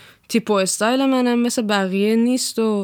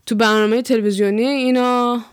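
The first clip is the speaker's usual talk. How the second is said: ordinary talk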